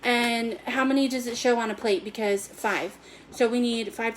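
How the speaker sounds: ordinary talk, nothing marked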